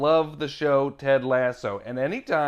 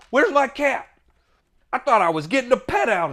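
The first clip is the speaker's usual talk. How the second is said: mock outrage; 8 semitones higher, 4 dB louder, faster than usual. Mock demand for the cat.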